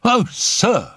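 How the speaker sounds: high, alarmed cry; agitated housemaid. A narrator's reading. Housemaid bringing bad news to the doctor, agitated. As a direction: burst out in a high-pitched panic. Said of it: "an agitated housemaid rushed".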